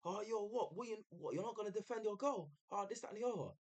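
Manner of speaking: high-pitched imitation of a heckler; mock aggressive shouting. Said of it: "it sounded more aggressive".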